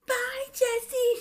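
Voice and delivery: very high, loud imitation; mock goodbye call. Darcy saying goodbye to Jesse, mock calling.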